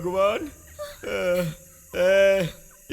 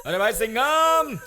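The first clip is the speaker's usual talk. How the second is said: mock calling; 4 semitones higher, slower than usual. Calling for lost dog mockingly.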